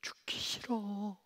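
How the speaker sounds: slow, high trembling whisper; whispered terror. Retold or imitated. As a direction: plead breathlessly, scared. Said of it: "무서워서 떨면서". (떨며 속삭이는 겁먹은 아이)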